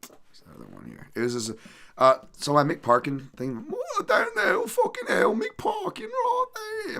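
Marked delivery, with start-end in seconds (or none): 3.7-7.0 squeaky, shrieked silly English voice; a dumb Englishman's squeal, way up high; mock English accent. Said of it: "My, my, my impersonations suck extra bad".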